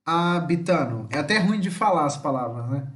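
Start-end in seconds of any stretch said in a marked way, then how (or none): 0.0-1.1 slow, stretched-out "Parlamene", "Abitano"; careful demonstration. Shown as a demonstration. Teacher giving hard-to-say Italian examples, careful demonstration. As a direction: sound out "Parlamene" and "Abitano" with a long first part. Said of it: "pra você acertar a sílaba tônica".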